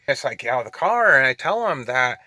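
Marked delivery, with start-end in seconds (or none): none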